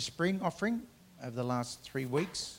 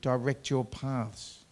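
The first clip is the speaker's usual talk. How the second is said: forceful preaching; 4 semitones higher, 8 dB louder than usual. Acted. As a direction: proclaim each line to the whole church. Preacher reading scripture with force.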